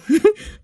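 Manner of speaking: short high laugh at her own slip; burst of laughter. Real, not acted. Vlogger laughs at misreading the card, high burst of laughter.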